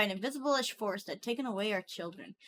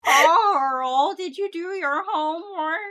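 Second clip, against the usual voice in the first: loud, high, drawn-out mock nagging; hammed-up scolding; 7 semitones higher, 10 dB louder, much slower than usual. Nagging Carl about his homework, mock scolding. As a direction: stretch the name out loud, then nag.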